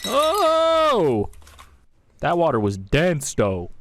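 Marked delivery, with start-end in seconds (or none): 0.1-1.3 high, loud startled yelp; surprised shout. Real, not acted. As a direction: blurt it out in shock.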